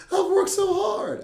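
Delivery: high, loud, slow sobbing complaint; imitated kid crying. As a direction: wail it through tears like a heartbroken kid. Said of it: "he bust out crying again".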